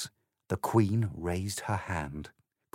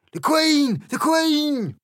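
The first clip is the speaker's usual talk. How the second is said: shrill high alarmed call, much louder; 8 dB louder, slower than usual. A narrator's reading. Six of clubs warns the cards the Queen is coming, shouting high. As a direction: sound the alarm across the garden. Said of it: "called out".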